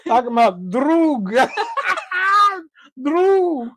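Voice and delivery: high laughing repeat of droog. Real, not acted. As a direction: crack up while saying droog. Laughing at the word.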